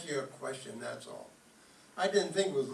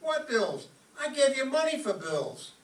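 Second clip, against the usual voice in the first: alarmed; 9 semitones higher, 4 dB louder, slower than usual. Acted. Husband alarmed about bills.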